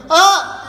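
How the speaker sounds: high, loud laughing scream. Retold or imitated. Rider screaming on roller coaster drop, loud scream. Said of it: "I'm screaming".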